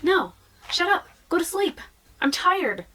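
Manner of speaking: high, whiny mock protest; pleading exasperation. Tired person telling their brain off, exasperated.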